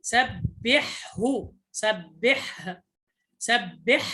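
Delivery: "سبحه" slowly, the ha fully breathed before the final ha; clear ha. Shown as a demonstration. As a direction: draw out "سبحه" so the throat ha stays distinct. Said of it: "تظهر الحاء فتقول".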